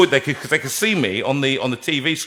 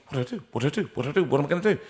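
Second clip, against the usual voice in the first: inward panic; 3 semitones higher, 6 dB quieter, much faster than usual. Retold or imitated. Panicked thoughts while still performing.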